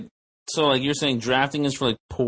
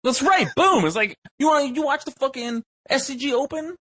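hyped rant; 12 semitones higher than usual. Real, not acted. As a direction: pump it up like backing a buddy.

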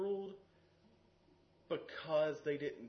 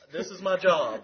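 firm retort; 4 semitones higher, 14 dB louder than usual. Retold or imitated. Retold argument with angry player.

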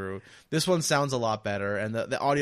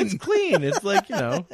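laughing defense; 9 semitones higher, 6 dB louder than usual. Real, not acted.

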